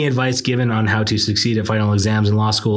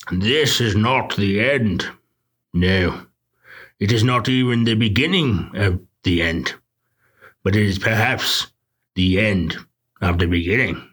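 Churchill impression. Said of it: "I will imitate it for you".

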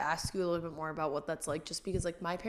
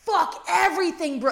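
furious outburst; 10 semitones higher, 9 dB louder than usual. Retold or imitated. After the dog pees in the house: furious yell. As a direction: explode over something tiny. Retold blowup over dog.

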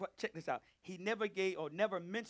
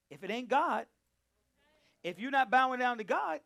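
louder and higher with each repeat; preacher hammering the point louder; building preaching shout; 7 semitones higher, 12 dB louder than usual. Real, not acted. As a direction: drive it home, rising to a shout.

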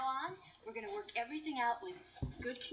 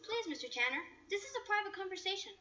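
lightly voiced snooty girl; 9 semitones higher than usual. Voicing Kimmy.

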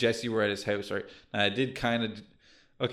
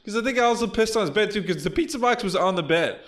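laughing; 7 semitones higher than usual. Real, not acted. Still laughing about the prank.

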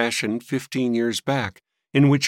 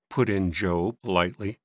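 ordinary delivery

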